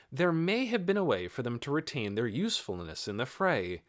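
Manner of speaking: ordinary delivery, nothing marked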